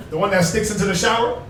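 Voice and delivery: normal talking voice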